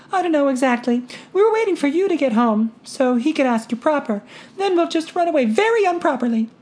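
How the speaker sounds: put-on high girlish voice, bright and eager; bubbly daughter. A narrator's reading. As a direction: chatter on happily. Daughter explains plans.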